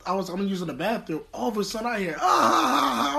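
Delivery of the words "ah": high startled yell from the next room; imitated scream. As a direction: let out a sudden high yelp. Roommate screams in bathroom.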